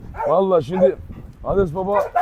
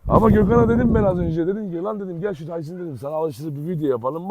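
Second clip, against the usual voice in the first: normal talking voice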